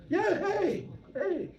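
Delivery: high calling "Yeah, hey, hey". Retold or imitated. Dealer hailing the monk from across the street, loud. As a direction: call out again, high and bright.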